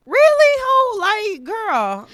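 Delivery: very high, loud incredulous scold; mock scolding. Critic telling off the movie heroine, loud mock scolding.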